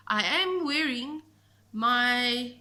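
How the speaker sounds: usual speaking voice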